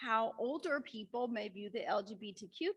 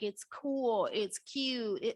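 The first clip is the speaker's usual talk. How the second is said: usual speaking voice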